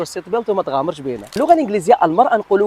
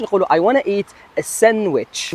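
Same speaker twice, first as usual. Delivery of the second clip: ordinary talk, nothing marked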